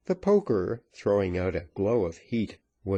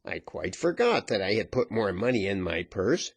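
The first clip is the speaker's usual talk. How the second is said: ordinary delivery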